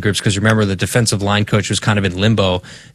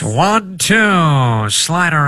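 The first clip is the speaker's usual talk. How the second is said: put-on higher old-timey pitch call; old radio announcer; 7 semitones higher, slower than usual. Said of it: "I'm gonna do an old-timey radio voice".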